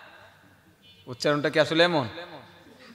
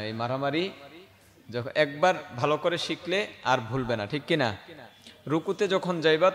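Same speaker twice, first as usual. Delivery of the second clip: ordinary delivery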